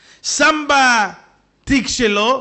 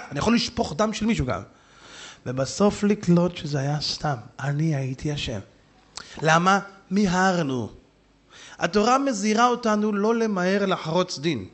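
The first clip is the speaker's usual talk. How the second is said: ordinary delivery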